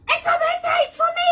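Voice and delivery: very high, loud excited yell; squealing holler from the audience; heckling shout. Retold or imitated. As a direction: shout it eagerly to claim a prize. Acting her heckle for the CD.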